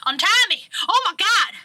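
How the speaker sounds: high, loud acted cry for release; hammed-up panicked shout; panicked plea. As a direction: beg loudly to be untied. Restrained Gregor pleads.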